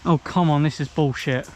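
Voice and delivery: loud furious complaint; angry shout. Real, not acted. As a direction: bark it out in anger. Frustrated player in game.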